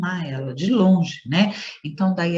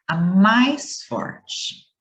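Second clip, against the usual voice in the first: "mais" stretched out slowly in "a mais forte"; stress on MAIS; much slower than usual. Shown as a demonstration. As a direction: draw out "mais", then let "forte" fall. Teacher stresses the key point. ("mais" bem alongado e enfático)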